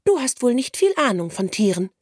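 high, know-it-all sparrow; lecturing. A narrator's reading. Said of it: "belehrte sie plötzlich der Vogel".

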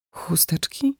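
hushed, puzzled echo with a rising end; bewildered near-whispered question; disoriented. A narrator's reading. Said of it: "powtarza zdezorientowana".

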